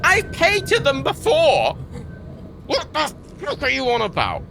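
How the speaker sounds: high, slow outraged question; exasperated retort. Retold or imitated. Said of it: "I'm like".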